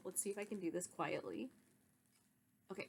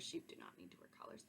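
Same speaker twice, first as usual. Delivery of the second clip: quick whispered correction, faster; whispered aside; 12 dB quieter, faster than usual. Real, not acted.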